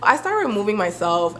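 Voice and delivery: usual speaking voice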